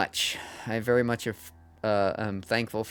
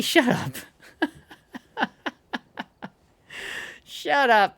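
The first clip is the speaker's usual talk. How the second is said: laughing; 14 semitones higher, slower than usual. Real, not acted. Teased by friends in chat.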